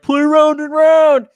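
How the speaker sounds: high, slow, drawn-out holler; imitated crowd shout. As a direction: shout a request from the back bar. Crowd yells request from back bar.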